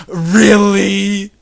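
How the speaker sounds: high, loud drawn-out really; appalled disbelief. Real, not acted. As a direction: stretch it out in disbelief. Reader disbelieves the story.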